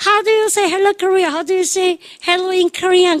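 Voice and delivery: high, slow repeated stage question; imitated star, pitched well up and drawn out. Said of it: "[name]가".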